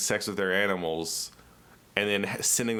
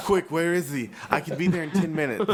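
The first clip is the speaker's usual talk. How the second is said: eager cougar; 6 semitones higher than usual. Pretend.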